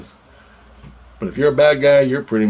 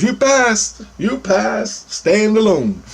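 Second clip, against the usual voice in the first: much higher voice for Christ announcing the test; 12 semitones higher, much slower than usual. Retold or imitated. Christ telling the dreamer he passed, high-pitched. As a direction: lift the voice way up, a solemn messenger. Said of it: "Christ goes".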